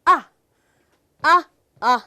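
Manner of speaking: crisp, separate "ਆ" bursts; short open vowel on "ਆ"; short A sound. Shown as a demonstration. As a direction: say "ਆ" short, never the letter name. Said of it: "ਇਹਨੂੰ ਆਪਾਂ pronounce ਕਰਦੇ ਆ".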